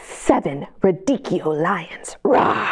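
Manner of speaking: slow whispered lions and a whispered roar. Acted. Teacher reading lion page to kids: whispered roar. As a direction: hush it like a secret, even the roar.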